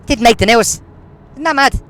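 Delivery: loud, indignant "Didn't make the news". Shown as a demonstration. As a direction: hit it hard with outrage. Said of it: "And guess what?".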